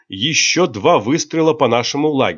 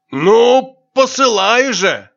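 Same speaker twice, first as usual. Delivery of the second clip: impatient shout; 11 semitones higher, 5 dB louder, much slower than usual. A narrator's reading. Impatient drinking companion.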